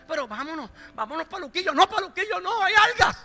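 high, loud mock protest; acted squabble. Two contrary churchgoers squabbling over Luquillo beach, hammed-up protest.

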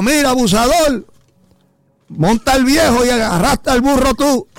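high-pitched retold yell of outrage; passerby's scolding shout. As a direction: imitate a heckler scolding from a car. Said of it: "Pasó otro carro y le dijo".

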